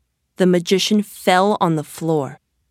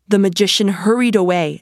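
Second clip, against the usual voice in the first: usual speaking voice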